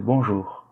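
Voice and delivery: "bonjour" with R between silent and heavy; balanced light R. Shown as a demonstration. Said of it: "C'est entre les deux". (« bonjour » avec un R discret, entre les deux)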